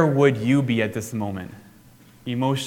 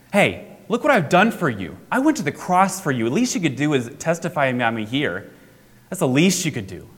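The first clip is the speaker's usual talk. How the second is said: mock guilt trip; 3 semitones higher than usual. Pretend Jesus guilting Paul into the mission, hammed-up reproach. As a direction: lay the guilt on thick. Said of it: "He doesn't guilt him".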